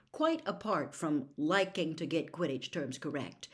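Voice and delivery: ordinary delivery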